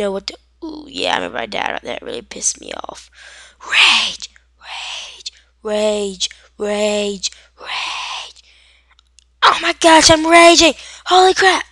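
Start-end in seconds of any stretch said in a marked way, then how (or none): 3.7-8.4 hammed-up rage, varying in force; mock rage. Acting out gamer rage, word repeated. 9.4-11.6 high, full-volume mock freak-out; sudden blasting high shout; loud raging. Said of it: "Sorry if that got really loud".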